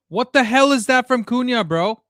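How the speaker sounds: exasperated fan shouting at the match; furious yell. Real, not acted. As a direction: yell in disbelief at the player. Furious at player's mistake.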